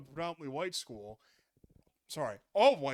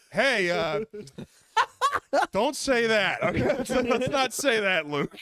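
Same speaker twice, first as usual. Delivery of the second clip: louder, nervous laughing scold, slow; awkward lifeguard; 6 semitones higher, 8 dB louder, slower than usual. Retold or imitated. Lifeguard telling Luke to stop, awkward scold. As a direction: gently scold a kid, uneasy.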